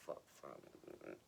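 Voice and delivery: soft disgusted whisper; inner thought whisper. Retold or imitated. Her own cringing thought while he flirts, whispered. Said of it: "I'm thinking".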